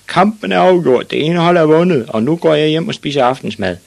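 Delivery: loud, high announcement, easing slightly toward the end; screaming. Acted. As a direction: shout the result to the whole stadium. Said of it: "skreg dommeren".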